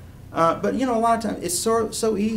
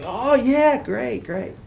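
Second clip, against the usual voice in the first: dismissive; 9 semitones higher, 4 dB louder, much slower than usual. Retold or imitated. Retold dismissive friend answers him.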